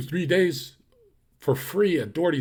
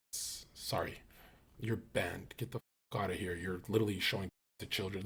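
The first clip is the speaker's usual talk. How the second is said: much softer, breathy whispered dismissal; whispered ban; 6 semitones lower, 13 dB quieter than usual. Real, not acted. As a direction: mutter the ban under your breath. Banning a chatter offhand.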